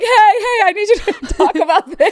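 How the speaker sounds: high, loud hailing through laughter; imagined pushy fan. Acted. Imagined fan flagging down the podcaster, hammed-up urgent yell. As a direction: call out urgently, cracking up as you mimic. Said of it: "He's like".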